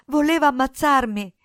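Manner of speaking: high, loud, panicked account; desperate cries tumbling out; frantic shouting. A narrator's reading. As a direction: pour it out as a terrified boy. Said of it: "gridò Cinto".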